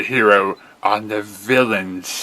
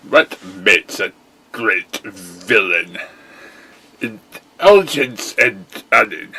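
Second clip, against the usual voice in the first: ordinary talk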